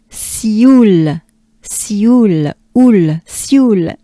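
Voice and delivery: "Sioule" with the U held and pushed; focus on U. Shown as a demonstration. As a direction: punch the U in Sioule for learners. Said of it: "focus on the U".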